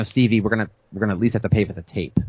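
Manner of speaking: low, hesitant mumbled request; mildly sheepish, stumbling over the ask. Retold or imitated. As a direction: awkwardly broach it, low and halting. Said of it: "kind of sheepishly".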